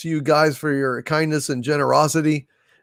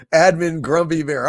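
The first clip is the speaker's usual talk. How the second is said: ordinary talk, nothing marked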